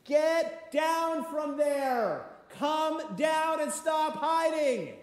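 high, drawn-out shout up to someone; calling out. Acted. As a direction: call up loudly, stretching every word. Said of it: "he calls out to Zacchaeus".

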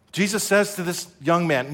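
ordinary delivery

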